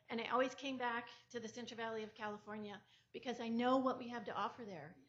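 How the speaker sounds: ordinary talk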